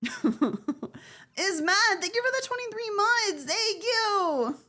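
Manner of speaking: very high squeaky thank-you; high-pitched thanks. Real, not acted. Streamer thanks a member for months of support, high squeal. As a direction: lift the whole thanks way up high.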